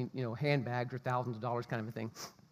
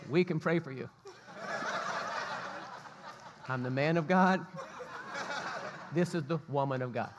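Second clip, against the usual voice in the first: mock pompous. Mock grand pastor self-intro.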